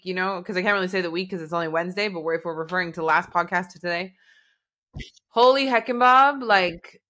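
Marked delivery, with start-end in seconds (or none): 5.3-6.4 loud, high-pitched mild oath; exasperated exclamation. Shown as a demonstration. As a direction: burst out with a folksy exclamation. Exclaims about the week they had.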